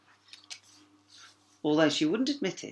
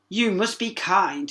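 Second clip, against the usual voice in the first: slow, higher parent voice; parent reminding; 6 semitones higher, 6 dB louder, slower than usual. A narrator's reading. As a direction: remind her firmly. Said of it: "her parents reminded her".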